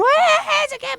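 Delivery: high, loud imitated crowd cheer; imitating churchgoers cheering the resurrection; mock cheering. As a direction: cheer like fans at a movie finale.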